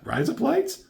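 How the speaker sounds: high-pitched rising question; acted bewildered echo, high at the end; puzzled questioning. She tries it, disbelieving.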